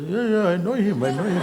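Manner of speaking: high, lively "yeah, yeah", half laughing; imitating a driver's cheerful insistence; eager agreement. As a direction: chime in keenly, sure of it.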